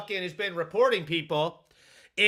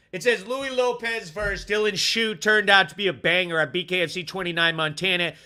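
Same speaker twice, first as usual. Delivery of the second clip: ordinary talk, nothing marked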